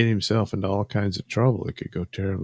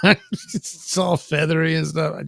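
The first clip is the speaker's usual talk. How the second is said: higher, slow, through a laugh; 7 semitones higher than usual. Real, not acted. Describing a forgotten feathery scarf, laughing. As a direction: let laughter run through the words.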